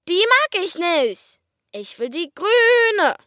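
hoch, langsam und quengelig beharren; trotzig. Acted. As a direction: das Kind stemmt sich gegen alle. (Kind besteht quengelnd auf der grünen Badehose)

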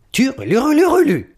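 a slow, high, grudging trill; unenthusiastic chirp. Acted. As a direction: chirp back without enthusiasm. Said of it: "Das klingt nicht besonders begeistert".

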